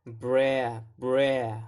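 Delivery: "bra" with a drawn-out A as in "bran", N left off; vowel drill. Shown as a demonstration. Teacher asks learners to imitate, "bra" stretched on the A of "bran". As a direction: isolate the gliding vowel in "bra". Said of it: "So try to imitate that".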